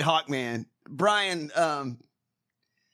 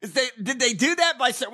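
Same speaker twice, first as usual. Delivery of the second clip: normal talking voice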